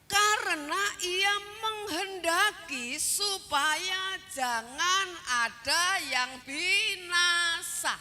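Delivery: slow, ringing pulpit delivery; emphatic scripture quoting. Acted. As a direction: draw out each word of the verse. Preacher quotes scripture emphatically.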